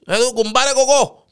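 loud, high call to the neighbour; calling through the wall. Acted. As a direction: shout the question next door. (φωνάζει από τον τοίχο)

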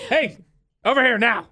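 high calling voice; appliance calling out. Retold or imitated. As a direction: call out like a bossy machine.

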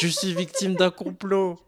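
high-pitched, hammed-up victim's complaint; mock protest. Host teased by co-hosts over racy scenes, mock protesting. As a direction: protest in a high voice, playing wronged.

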